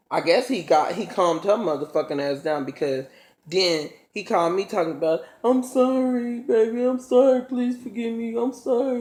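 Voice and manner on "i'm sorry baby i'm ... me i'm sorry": high-pitched mock begging; mimicked pleading. Boyfriend phoning to beg forgiveness, mimicked high pleading. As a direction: imitate him whining an apology, voice raised high.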